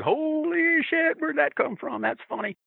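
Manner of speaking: jumps way up in pitch, slow and stunned; a stunned, high-pitched holy shit; amazed disbelief. Retold or imitated. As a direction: gasp it out like you can't believe it. Stan's amazed reaction quoted.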